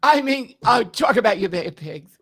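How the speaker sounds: high, loud brassy Joan Rivers; Joan Rivers impression. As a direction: bark the catchphrase like Joan. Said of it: "it's very close to, Joan".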